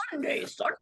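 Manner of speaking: booming radio ad announcer, hammed up; monster truck ad voice. Mocking the radio voice for Dan's turn. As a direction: hype it like a radio spot. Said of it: "No, you'll do it in, like".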